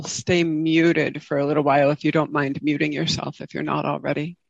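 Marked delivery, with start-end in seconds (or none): none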